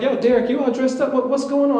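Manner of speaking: high, loud, excited mock questioning; excited coworkers. Coworkers ask Derek why he's all dressed up, excited. Coworkers react to his outfit.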